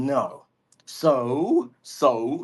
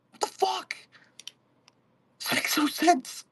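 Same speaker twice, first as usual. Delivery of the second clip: high strained voice; high disbelief; 11 semitones higher, 4 dB quieter than usual. Real, not acted. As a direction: squeak it out in frustration.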